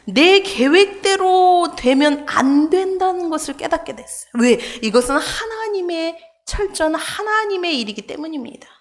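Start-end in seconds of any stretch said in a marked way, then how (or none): none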